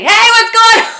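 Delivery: booming, high-pitched shouted greeting; yelling husband. Retold or imitated. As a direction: holler it like a coach just off the field. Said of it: "And he's yelling".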